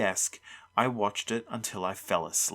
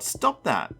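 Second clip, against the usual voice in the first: higher, sharp objection; yelled protest; 8 semitones higher than usual. A narrator's reading. Boy to monsters at his dresser, yelling. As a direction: order them to stop, pitch raised. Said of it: "I yelled".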